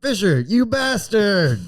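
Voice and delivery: high, slow outraged cry; outraged shout. Acted. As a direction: shout at the friend who cursed him. Turned into a creature.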